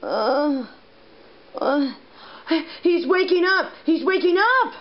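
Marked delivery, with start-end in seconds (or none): 2.8-4.7 excited. Pretend. Patient wakes up.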